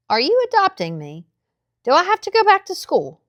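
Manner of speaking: put-on a small boy daring to hope; hopeful boy. A narrator's reading. Boy asks Old Ray if he is adopted, hopeful. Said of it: "the hope was evident in his words".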